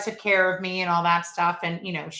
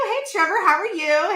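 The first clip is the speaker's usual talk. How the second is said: a higher, bright sing-song hello; testing a cheerful greeting; trial greeting; 10 semitones higher, 4 dB louder than usual. Shown as a demonstration. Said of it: "Hey, that sounded weird".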